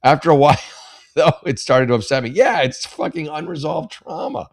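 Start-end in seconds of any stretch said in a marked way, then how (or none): 2.3-4.5 exasperated. Real, not acted. Exasperated reaction to the letter.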